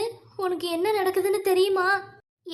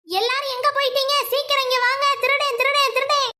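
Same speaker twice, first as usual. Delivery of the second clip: higher, louder alarm cry; shouting for help; 7 semitones higher, 4 dB louder than usual. Acted. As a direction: raise the house: thief, thief. Calling household, crying thief.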